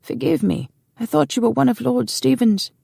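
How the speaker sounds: put-on high-pitched apologetic old servant; flustered old man. A narrator's reading. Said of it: "the old man gasped".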